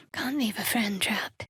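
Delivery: soft whisper while sneaking. Acted. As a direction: keep it under your breath. Sneaking quietly.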